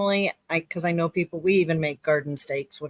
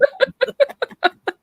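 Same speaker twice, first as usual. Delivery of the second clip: high burst of laughter; 5 dB louder than usual. Real, not acted. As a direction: laugh out loud at the joke. Laughing at the joke.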